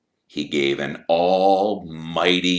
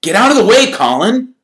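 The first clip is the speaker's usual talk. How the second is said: high, loud snapped order; shouted at the pest; angry; 12 dB louder, faster than usual. A narrator's reading. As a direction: snap at him to move. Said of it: "said Harry angrily".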